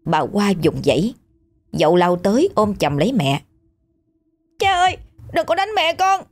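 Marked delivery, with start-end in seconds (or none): 4.6-6.2 put-on much higher, pleading child's cry; desperate plea. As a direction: beg your father, terrified. Son begs father to stop.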